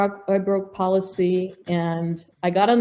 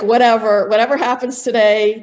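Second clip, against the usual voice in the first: defiant resignation; 3 semitones higher, 7 dB louder than usual. Retold or imitated. Resigned shrug, voice raised.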